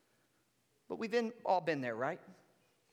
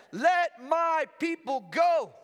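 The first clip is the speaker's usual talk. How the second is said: loud, slow, higher proclamation; commanding demand; 6 semitones higher, 6 dB louder, slower than usual. Acted. Preacher voicing Moses to Pharaoh, loud slow command. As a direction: declare it like a prophet before a king.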